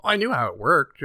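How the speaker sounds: usual speaking voice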